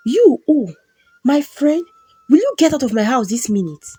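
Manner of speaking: husband yelling at the woman who tricked him to get out; furious shout. Acted. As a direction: throw her out of the house at the top of your voice. Husband throws out lover.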